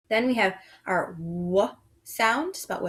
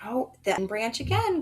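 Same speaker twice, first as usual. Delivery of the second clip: ordinary talk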